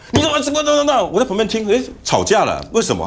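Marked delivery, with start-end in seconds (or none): 0.1-1.0 high, stammering mock outrage; imitated sputtering anger. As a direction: sputter like someone just insulted. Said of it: "人就翻脸哦". (模仿气得结巴的质问)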